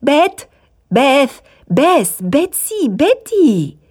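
high, ringing calls; calling out. A narrator's reading. The brothers summon their housekeeper by her nicknames, calling out. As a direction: summon someone by name, pitched well up. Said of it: "les surnoms de la femme de charge retentirent".

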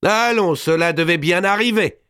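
high, loud scornful outcry; loud cry. A narrator's reading. Mao cries out at Robert's claim, high and loud. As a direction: Mao shouts across the hall. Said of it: "une voix presque aussi forte s'écrier".

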